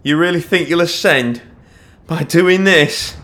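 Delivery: high, loud, incredulous shout. A narrator's reading. Watchwoman challenging the fugitive on the roof, shouting. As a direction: shout it, stretching the last words. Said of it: "Nia shouted".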